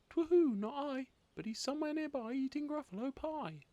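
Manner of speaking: high, loud hooting owl. Acted. Owl answering the Gruffalo's child, hooting high voice. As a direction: hoot it out like an owl.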